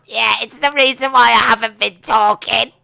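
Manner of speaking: loud, high put-on voice; silly imagined groom voice. Groom explains his silence, silly high voice. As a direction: strain it high and loud, mock-earnest. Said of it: "is that how you sound?".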